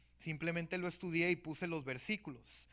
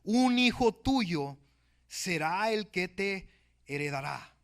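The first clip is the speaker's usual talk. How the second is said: very slow, louder, majestic decree; voice of God; 3 semitones higher, 10 dB louder, slower than usual. Acted. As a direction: declare it as God answering Abraham. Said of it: "Dios le responde".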